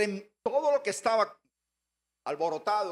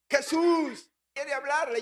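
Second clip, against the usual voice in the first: a raised, slow, pleading insistence; insistent usher; 5 semitones higher, 4 dB louder, slower than usual. Retold or imitated. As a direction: press him to come, voice lifted.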